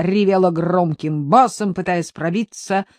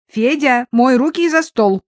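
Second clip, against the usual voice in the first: higher, louder bossy sister; raised, commanding family voice; giving orders; 6 semitones higher, 6 dB louder than usual. A narrator's reading. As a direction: order him about briskly. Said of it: "распорядилась Дуська".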